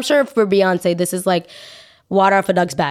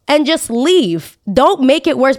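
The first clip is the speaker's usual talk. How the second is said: louder, slow pleading rant; drawn-out, exasperated plea to just leave; 5 semitones higher, 3 dB louder, slower than usual. Real, not acted. Vlogger ranting at [name], exasperated and loud.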